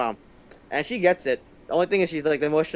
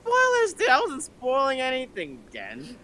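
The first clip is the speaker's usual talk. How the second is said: high mock whine; 11 semitones higher than usual. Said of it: "I'm like".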